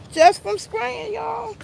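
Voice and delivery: high, slow, exaggerated whine; milk the exhaustion for laughs; dramatic breathless complaint. Real, not acted. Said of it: "I'm out of breath".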